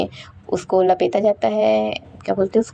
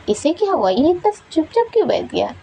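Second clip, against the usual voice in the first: higher, surprised question about a friend; puzzled surprise; 8 semitones higher than usual. Acted. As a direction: ask it like he can't believe his eyes. Yunlain puzzled by quiet Wahi.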